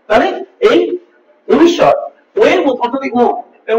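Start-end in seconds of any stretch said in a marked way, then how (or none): none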